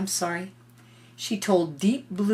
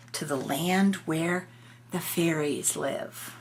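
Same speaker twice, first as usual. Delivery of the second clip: usual speaking voice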